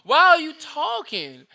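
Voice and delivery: rising incredulous complaint about early talk; fed up at six in the morning; exasperated. Real, not acted. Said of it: "six A M".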